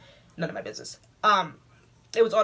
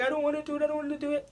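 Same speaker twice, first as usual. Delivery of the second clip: crying; 7 semitones higher, 4 dB quieter, faster than usual. Retold or imitated. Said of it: "I was crying".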